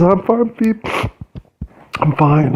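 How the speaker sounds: soothing the dog through tears; tearful reassurance to dog. Real, not acted. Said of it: "I can't even compose myself".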